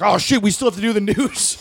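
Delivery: high, laughing mock panic; panicked coworker.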